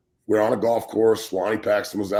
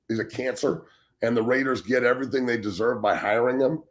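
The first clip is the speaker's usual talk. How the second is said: usual speaking voice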